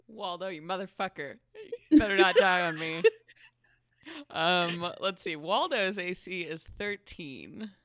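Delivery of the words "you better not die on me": fast, loud, half-sobbing plea. Real, not acted. Player yelling at her familiar to stay alive. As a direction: beg him not to die, half crying.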